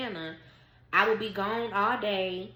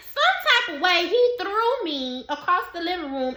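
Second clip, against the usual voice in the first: excited outburst; 10 semitones higher, 9 dB louder than usual. Real, not acted. Retelling the fight, excited.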